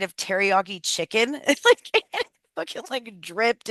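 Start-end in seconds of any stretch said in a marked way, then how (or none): 1.3-3.7 words breaking up into giggles; laughing. Real, not acted. Telling viewers about the teriyaki spill, laughing. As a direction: laugh through the words as the sauce drips. Laughing at her own mishap.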